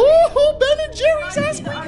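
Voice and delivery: very high squealing cheer; excited cheer. Real, not acted. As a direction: squeal it with delight. Cheering for ice cream.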